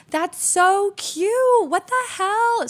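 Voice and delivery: imitating passing girls cooing at the mole; Valley Girl imitation. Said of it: "I'm imitating all, like, the girls that were stopping by".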